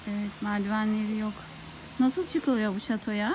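usual speaking voice

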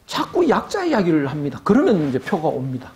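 ordinary talk, nothing marked

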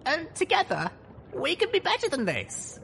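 put-on high, fast determined young mare; young heroine voice. A narrator's reading. Voiced heroine Little Pip.

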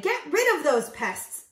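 high, loud demand; shouting. A narrator's reading. Moose herd demands the guests go, shouting. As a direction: shout it as an order. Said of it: "All Thidwick's friends shouted".

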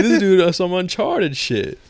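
amazed. Retold or imitated. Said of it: "I'm like".

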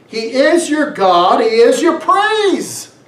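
ordinary delivery

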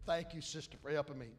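usual speaking voice

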